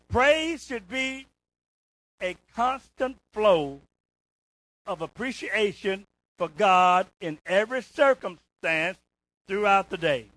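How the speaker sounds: very slow, loud, spaced-out declaration; slow emphatic reading. Real, not acted. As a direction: lay each word down slowly for the flock. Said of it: "All right, listen to this".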